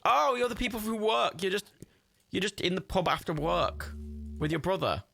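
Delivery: high chatty put-on voice; high put-on voice, chatting to the pub locals; lightly voiced chirpy character. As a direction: chat up the pub regulars in a high voice.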